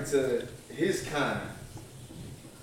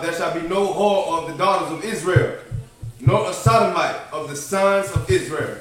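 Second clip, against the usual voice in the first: loud, declaiming Bible verse; scripture reading; 3 semitones higher, 8 dB louder than usual. Acted. Cohost reads Deuteronomy to the show, loud scripture recitation. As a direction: read the verse out like from a pulpit.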